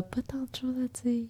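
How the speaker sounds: breathy whisper into the mic. Real, not acted. Guest shyly whispering into the podcast microphone. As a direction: keep it barely voiced, close to the mic. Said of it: "Tu parles dans le micro".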